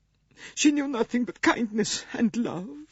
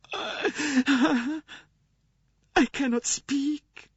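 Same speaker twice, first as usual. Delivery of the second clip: much higher, tearful, breaking voice; louder high weeping nurse; 3 dB louder than usual. A narrator's reading. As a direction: sob it out, grief-stricken. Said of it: "wept the wretched woman".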